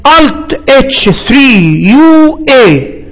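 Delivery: very slow, spaced-out keystrokes; teacher dictating at a crawl; slow dictation. Real, not acted. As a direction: spell out the shortcut slowly, key by key. Teacher dictates the shortcut step by step.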